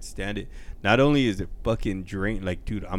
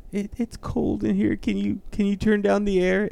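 much higher fussy complaining voice; complaining residents; 12 semitones higher than usual. Retold or imitated. Residents asking him to turn down the air, fussy high voice. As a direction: whine like a chilly old resident.

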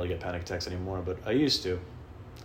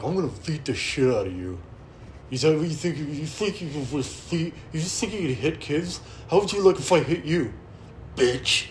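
louder, higher mock rant; cursing principal; 6 semitones higher, 4 dB louder than usual. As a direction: rage at the kid like an unhinged principal. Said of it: "was fucking cursing".

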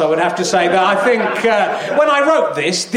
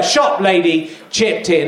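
ordinary talk, nothing marked

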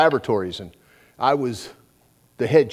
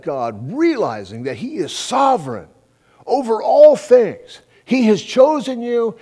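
higher, slower, swelling preaching; preaching at full force; 8 semitones higher, 5 dB louder, slower than usual. Acted. Preacher urges gang to let go and let God, fervent. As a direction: build it up and preach it out.

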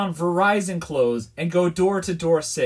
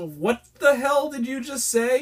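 high, stretched-out incredulous question; baffled disbelief; 7 semitones higher than usual. Retold or imitated. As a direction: retell it as stunned disbelief. Retold baffled reply to coworker.

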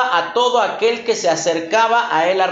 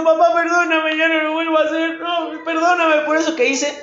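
put-on pleading child; 5 semitones higher than usual. Child crying begging father.

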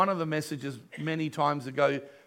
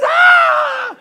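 a high, loud shriek of surprise; surprised cry; 12 dB louder than usual. Retold or imitated. Pastor voicing the warehouse owner's amazed cry, high shout. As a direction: let out a sudden amazed shout. Owner learns whose son he is.

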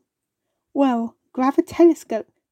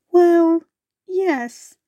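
stammering; 6 semitones higher, 5 dB louder than usual. Acted. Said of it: "stammered the bubble wasp".

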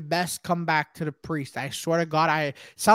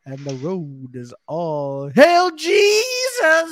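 mock preacher; 5 dB louder, much slower than usual. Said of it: "He goes".

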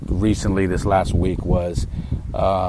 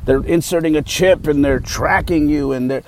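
high-pitched mock alarm; mocking, raised-voice panic about a plot; crazy conspiracy talk; 6 semitones higher than usual. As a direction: imitate a worked-up conspiracy theorist. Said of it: "people talking some craziness".

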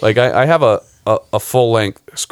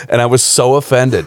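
ordinary delivery

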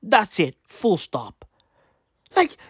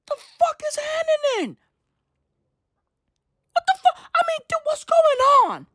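high squeaky what is happening; cartoonish high-pitched disbelief; mock falsetto outrage. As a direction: shriek it in a silly high voice. Exasperated high put-on rant.

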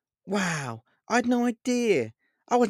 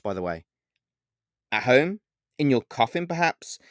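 sarcastically; 5 semitones higher, 3 dB louder than usual. A narrator's reading. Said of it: "I replied sarcastically".

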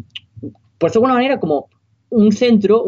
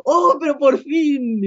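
joyful relief; 9 semitones higher, much slower than usual. Retold or imitated. Retold joy at the reunion.

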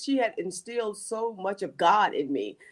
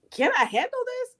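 pleading to God. Retold or imitated. Pleading cry to God, high.

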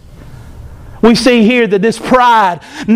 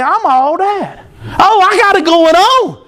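vain boasting; 8 semitones higher than usual. Retold or imitated. Lucifer admiring himself.

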